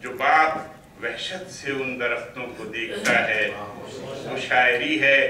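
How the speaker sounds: declaimed Urdu verse, deliberate pauses between phrases; poetry recital. Acted. Poet reciting to an appreciative mushaira audience, poetry recital. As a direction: swell on repeated lines, ease down on the refrain.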